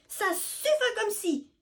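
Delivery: high, loud flustered shout; stammering cry. Acted. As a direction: burst out, stumbling. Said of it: "crie Biscotte en bafouillant".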